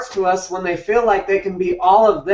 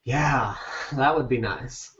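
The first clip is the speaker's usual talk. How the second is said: dry wistful aside; 5 semitones lower, 9 dB quieter than usual. Retold or imitated. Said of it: "Women that are listening right now are like".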